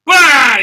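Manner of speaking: loud, high exclaimed impact; explosive comic-book bang; imitated violent bang. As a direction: shout the sound effect. Imitates arm being ripped off.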